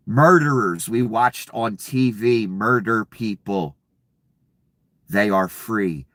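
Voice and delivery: usual speaking voice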